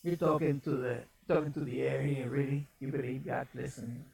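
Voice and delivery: low, hushed tempter's voice; insinuating whisper. Acted. Satan whispers doubt to someone praying, low insinuating murmur. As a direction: drop low and soft, planting doubt in the ear. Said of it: "he's gonna whisper in your ear".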